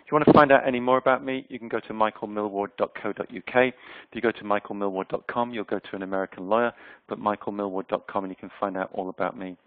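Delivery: ordinary delivery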